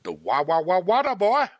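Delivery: mock hillbilly babble rising to a yell; Farmer Fran. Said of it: "Fucking Farmer Fran, dude".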